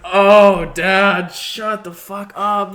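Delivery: high, loud exasperated groan, drawn out slowly; exasperated daughter. Retold or imitated. Daughter scolding her dad in the audience, loud exasperated. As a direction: scold dad in mock horror. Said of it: "And I was like".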